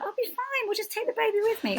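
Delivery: mock-breezy high voice of past self; breezy optimism. First-time mum planning to bring the baby, mock breezy.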